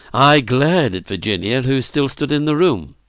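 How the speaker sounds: usual speaking voice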